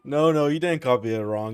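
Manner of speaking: ordinary talk, nothing marked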